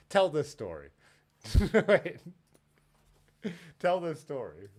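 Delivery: high, loud, through laughter; laughing urging. Real, not acted. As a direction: urge him to tell it while cracking up. Cracking up while urging him on.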